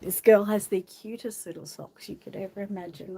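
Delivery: normal talking voice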